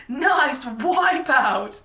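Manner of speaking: laid-back surfer dude. Retold or imitated. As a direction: stretch it like an amused ski bum. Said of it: "the most ski bum looking dude".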